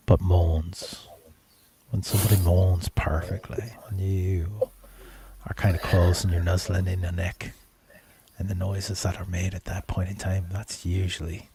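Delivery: low, intimate musing with lingering pauses; sultry. Real, not acted. Host describing what they find sexiest, low and sultry. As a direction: drop the voice low and savour it.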